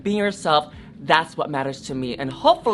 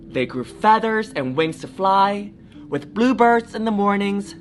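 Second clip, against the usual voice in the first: put-on lively read-aloud for children; storybook reading; 3 semitones higher than usual. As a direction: tell it like a bedtime story. Story narration.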